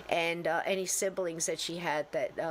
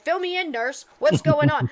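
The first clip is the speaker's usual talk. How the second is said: high, loud, urgent demand; rushed ER doctor; 7 semitones higher, 8 dB louder than usual. Acted. Doctor running into emergency surgery, urgently questioning the nurse. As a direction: burst in like a doctor taking charge. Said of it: "I felt like a doctor running into surgery room".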